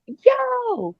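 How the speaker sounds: high-pitched mock yell, no real volume. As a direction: imitate someone calling out to a crowd. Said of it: "He yelled out".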